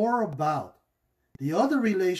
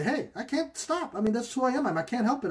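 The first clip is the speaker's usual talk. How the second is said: whiny excuse; 8 semitones higher, faster than usual. Acted. Spouse excusing shouting in argument.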